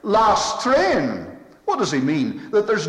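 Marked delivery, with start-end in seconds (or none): 0.0-1.3 called out high and loud like a porter; porter's call. Retold or imitated. Railway porter calling out to passengers, loud. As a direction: announce it down the platform. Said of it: "hear the porter shouting out".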